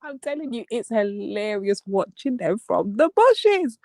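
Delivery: normal talking voice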